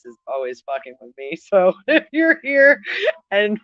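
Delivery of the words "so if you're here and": laughing. Real, not acted. Laughing while relaying spirit message.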